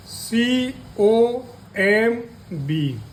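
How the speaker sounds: spelled out letter by letter, very slowly; slow spelling. Shown as a demonstration. Teacher spelling the word slowly for students. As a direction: sound out each letter slowly. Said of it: "ऐसे ही".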